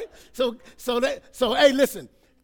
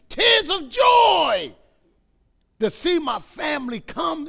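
raised, exultant preacher's voice; fervent preaching; 3 semitones higher, 4 dB louder than usual. Real, not acted. Preacher rejoicing over family coming to church, fervent. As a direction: preach it high, stretching key words out.

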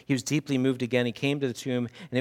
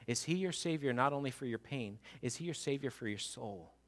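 much softer, intimate preaching; hushed appeal; 7 dB quieter than usual. Acted. Preacher asking each listener personally, hushed and soft. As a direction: lean in and ask them gently.

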